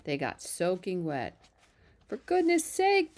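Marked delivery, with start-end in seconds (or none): 2.1-3.2 high, loud exasperated bellow; drenched Zuckerman bellowing, high and loud. A narrator's reading. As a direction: bellow it, soaked and fed up. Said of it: "bellowed Mister Zuckerman".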